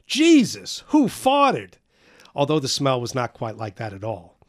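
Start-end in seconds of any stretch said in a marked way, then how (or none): 0.1-1.7 loud, high, disgusted howl; a coal-cellar man yowls out in shock; howling outburst. Acted. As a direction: bellow it up high at the stink. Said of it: "Mister Klopp howled".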